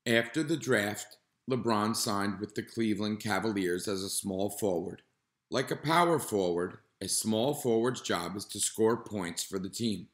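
put-on calm, even read-aloud voice for kids; reading to children. Teacher reading a nonfiction book to students, calm and clear. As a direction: read the page clearly to the class.

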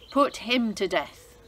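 a loud, commanding sentence from the king; royal order. Acted. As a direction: pronounce the verdict. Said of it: "the king ordered".

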